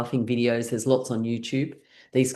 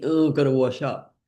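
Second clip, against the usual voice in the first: reluctant grumble. Pretend. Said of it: "You might be thinking".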